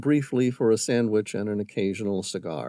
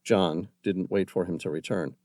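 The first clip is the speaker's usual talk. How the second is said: ordinary delivery, nothing marked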